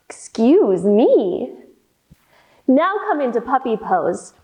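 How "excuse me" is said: slow, dainty mock apology; prim excuse me. Teacher plays the full giraffe, slow mock-polite excuse. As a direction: pretend to be polite after a burp.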